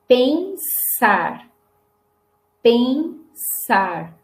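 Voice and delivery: pensar in two syllables with a long hissed s; som de S. Shown as a demonstration. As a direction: slowly model pensar, unvoiced s held before -ar. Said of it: "Agora é som de S". (pensar devagar, com S sibilante e longo)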